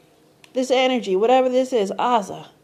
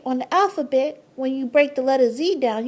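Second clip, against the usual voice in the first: ordinary delivery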